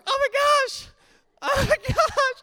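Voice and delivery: hammed-up panicked squeal; frantic panic. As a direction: shriek it while running. Running after the bus.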